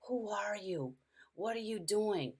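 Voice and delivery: hushed, half-whispered question; a frightened daughter asks an unseen stranger, whispering; confused whisper. Retold or imitated. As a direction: whisper it as a bewildered sick child. Sick daughter talks to nobody.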